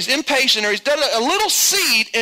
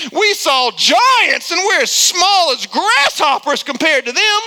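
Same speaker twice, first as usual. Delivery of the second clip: mock fearful report; 5 semitones higher, 5 dB louder, slower than usual. Spies' report quoted in sermon.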